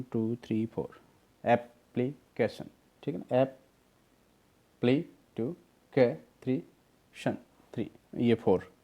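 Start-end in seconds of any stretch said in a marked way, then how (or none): 1.4-2.7 एप्लीकेशन slowly, ऐप-प्ली-केशन; short breaks inside एप्लीकेशन; split into syllables. Shown as a demonstration. Teacher recounting syllables, एप्लीकेशन slowly in pieces. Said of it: "ये four syllable है". 3.4-7.9 ऐप, प्ले, के, शन each alone, a number after; syllables counted off. Shown as a demonstration. Teacher counting four syllables, ऐप-प्ले-के-शन one by one. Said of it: "ये four syllable है".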